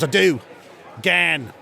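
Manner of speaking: lightly put-on Australian accent; Australian caller. Mel offers the contest word, light Australian accent. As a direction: answer as an Australian phone-in contestant. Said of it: "he does two different dialects of Australian".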